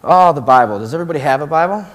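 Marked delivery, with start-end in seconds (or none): none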